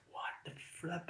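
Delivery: whispered disbelief; astonished hushed aside; stunned whisper. Retold or imitated. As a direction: breathe it out in shock. Stunned inner thought.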